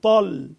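طل with a heavy, full-mouthed ṭ; طاء مفخمة; emphatic ta. Shown as a demonstration. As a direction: fill the mouth for the ṭ in طل. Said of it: "فالطاء مفخمة".

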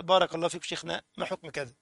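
soft, respectful asking, gentle and unhurried; polite question. Shown as a demonstration. A polite student asking the sheikh, softly. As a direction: ask the sheikh humbly. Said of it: "مثلًا يجي يسأل".

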